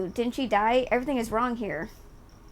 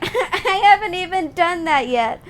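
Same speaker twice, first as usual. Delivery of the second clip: laughing; 6 semitones higher, 5 dB louder than usual. Real, not acted. Repeats it laughing.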